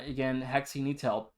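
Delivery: usual speaking voice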